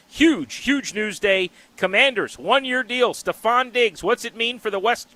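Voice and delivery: punchy sports radio promo, slow and emphatic on the hook; radio show tease. Acted. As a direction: sell the upcoming segment like a host.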